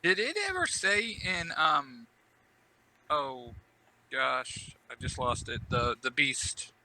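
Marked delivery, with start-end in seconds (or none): none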